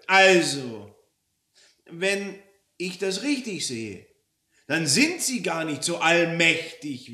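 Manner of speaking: high, loud, pointed reckoning; indignant customer working it out; Frau Roller deducing indignantly. Acted. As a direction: build the accusation piece by piece.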